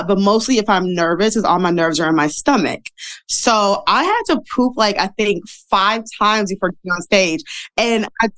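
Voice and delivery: normal talking voice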